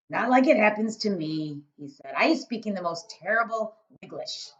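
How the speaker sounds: loud, booming friendly giant; a big-hearted giant rumbling away. Acted. BFG speaking.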